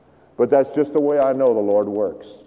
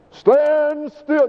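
much higher, slow, booming preacher proclamation; grand preacher Moses; 12 semitones higher than usual. Retold or imitated. Moses proclaiming to the frightened Israelites like a preacher. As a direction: ham up a pulpit pronouncement, drawn out. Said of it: "You go back and read that story".

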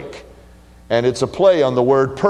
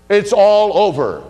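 emphatic declaration; 7 semitones higher, 4 dB louder than usual. Acted. Preacher declaring final doom.

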